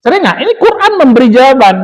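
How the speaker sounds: ordinary talk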